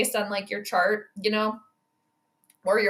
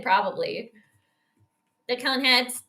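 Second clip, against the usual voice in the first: ordinary talk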